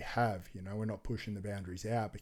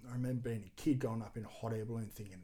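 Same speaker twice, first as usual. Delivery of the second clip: ordinary delivery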